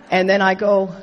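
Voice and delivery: usual speaking voice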